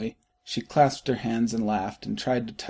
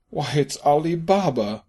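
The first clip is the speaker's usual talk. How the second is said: louder, higher burst of delight; ecstatic exclaim; 4 semitones higher, 5 dB louder than usual. A narrator's reading. Scrooge thrilled to recognise Ali Baba, ecstatic. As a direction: cry out in ecstatic wonder. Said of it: "Scrooge exclaimed in ecstasy".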